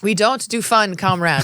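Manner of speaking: hammed-up party official decree; stern Soviet official. Echoes the Soviet joke louder.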